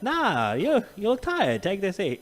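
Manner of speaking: soft, failed attempt at Canadian; slow, polite mock Canadian; bad Canadian accent. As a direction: fumble through a Canadian impression. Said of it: "I can't do Canadian".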